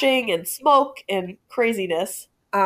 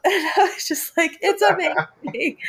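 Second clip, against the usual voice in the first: high giggling delight; laughing; 9 semitones higher than usual. Real, not acted. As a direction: laugh through the words. Laughing at the nerd cop.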